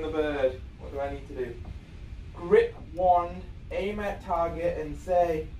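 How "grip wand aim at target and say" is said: ordinary talk, nothing marked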